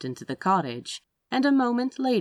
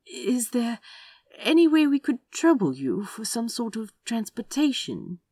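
careful, higher-pitched polite request; 7 semitones higher than usual. A narrator's reading. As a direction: tread delicately, hesitating after the first words.

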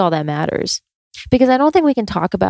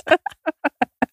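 nervous laugh; 10 semitones higher, 3 dB quieter than usual. Real, not acted. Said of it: "I can't stop laughing because I'm just fucking nervous".

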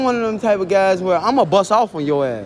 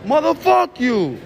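higher, slow mock of an angry stranger; imitating the rude guy snapping back; angry retort; 6 semitones higher, much slower than usual. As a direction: throw it back at him like an insult. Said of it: "He said".

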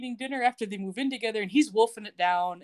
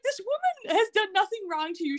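high indignant retort; mock outrage; 11 semitones higher than usual. Host objecting to sister's insult, mock outraged. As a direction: protest it in high disbelief.

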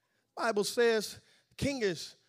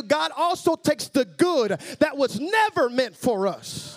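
rising, louder sermon delivery; preaching; 3 semitones higher, 9 dB louder than usual. Real, not acted. Preacher telling the church God also takes the good, building preaching. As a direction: build the sermon with driving emphasis.

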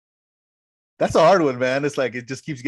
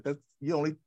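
a higher, smug retelling of the schemer's line; mock Vizzini; 7 semitones higher than usual. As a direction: gloat like the cocky villain, pitched up. Vizzini quote retold, mocking.

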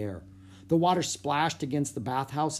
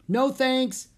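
high, loud shouted reply; shouting; 12 semitones higher, 5 dB louder than usual. A narrator's reading. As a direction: call back over the wind. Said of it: "shouted Jack".

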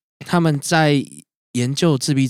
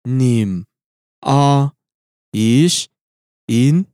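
倒着讲话. Shown as a demonstration. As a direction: speak the phrase backwards, syllable by syllable. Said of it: "我再讲一次". (一字一顿地倒着讲话)

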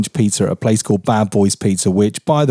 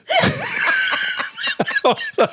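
shrieking laugh; 4 dB quieter than usual. Real, not acted. Burst of laughter.

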